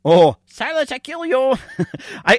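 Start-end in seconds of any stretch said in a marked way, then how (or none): 0.5-1.5 high-pitched puppet villain threat; mock threat in a high character voice; puppet catchphrase. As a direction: squeal out a comic catchphrase threat. Mimics a comedian's puppet catchphrase.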